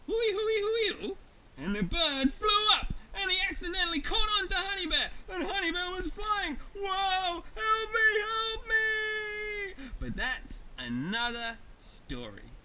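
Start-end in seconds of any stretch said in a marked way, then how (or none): 0.1-1.2 high, loud hooting; demonstrated bird call. Acted. Honey Bear demonstrates bird call. 1.6-6.6 loud, high storytelling rush; excited narration. A narrator's reading. 6.7-9.7 high, loud, drawn-out plea; acted panic. Honey Bear carried off, cries help.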